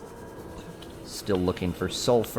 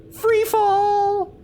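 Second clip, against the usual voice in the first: excited; much slower than usual. Real, not acted. Jumping, playful squeal.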